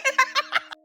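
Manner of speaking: amused burst of laughter; high laugh. Real, not acted. Narrator laughing at the Navy man's exit. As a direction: laugh at the bit.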